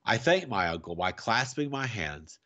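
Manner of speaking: ordinary delivery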